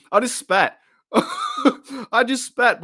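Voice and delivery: real laughter breaking through the words; giggling, pitch jumping up; laughing. Real, not acted. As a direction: crack up at your own spit. Laughs after spitting on stream.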